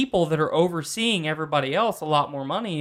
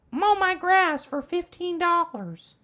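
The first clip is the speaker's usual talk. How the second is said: put-on old lady voice; 12 semitones higher, slower than usual. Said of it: "he goes to Grandma Jean".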